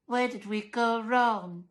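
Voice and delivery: a comically mournful question from Missus Dullard; mock sad lament. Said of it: "shook her head sadly".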